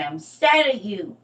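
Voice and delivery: put-on high, loud, slow kid's sulk; a kid pouting at Mom; child's pout. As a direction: drag it out like a sulking toddler. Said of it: "That's what they used to say".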